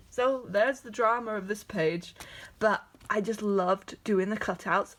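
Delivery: normal talking voice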